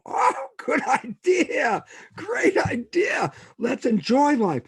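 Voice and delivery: high, slow, laughing cheer; gleeful excitement. Retold or imitated. Praising himself for a new plan, laughing and elated. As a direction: cheer your own idea with a laugh.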